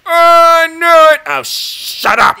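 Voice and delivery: loud, high-pitched yelp of dismay; loud real groan of disbelief; shocked outcry. Real, not acted. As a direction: cry out at the board in real frustration. Blunder noticed in chess game.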